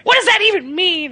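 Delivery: the teacher asking, shocked and lost; imitating the baffled teacher. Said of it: "She's like".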